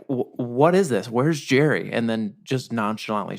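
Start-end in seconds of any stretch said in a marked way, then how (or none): 0.1-1.9 higher, slower, a bit louder puzzled demand; gangster's puzzled demand. Retold or imitated. Kidnapper meets Wade at the garage, puzzled and slow. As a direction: play the kidnapper, thrown by the wrong man. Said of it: "Steve Buscemi is like".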